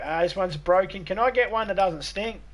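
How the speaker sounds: hammed-up parent asking for a new baby; mock complaining parent. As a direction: whine like a parent returning a faulty baby. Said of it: "you're like".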